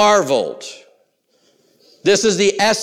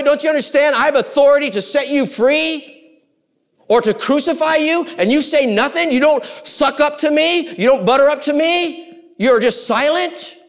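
a scornful governor, pitched up high; Pilate taunting; 10 semitones higher than usual. Acted. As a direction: sneer down at the silent prisoner. Preacher voices Pilate taunting Jesus.

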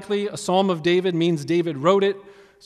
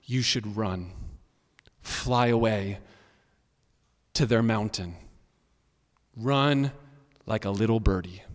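challenging voices; 7 semitones lower, 5 dB quieter than usual. Acted. Doubting voices urge David to flee, low and quiet. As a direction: drop low and quietly coax, pausing between phrases. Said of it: "being challenged by either voices outside or inside".

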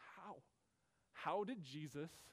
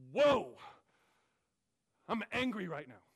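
loud, sharp, higher burst of anger on "Whoa"; angry woe; 5 semitones higher, 12 dB louder than usual. Shown as a demonstration. Preacher showing the angry reading of woe, loud and angry. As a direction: bark out "Whoa" like you're fed up. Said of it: "could be anger".

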